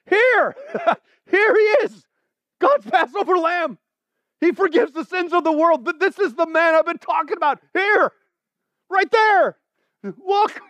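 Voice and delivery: very high, full-voiced excited shout; yelled out. Shown as a demonstration. John spotting Jesus coming toward him, yelling out. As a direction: yell it like a thrilled announcement. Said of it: "yelled out, I'll try again".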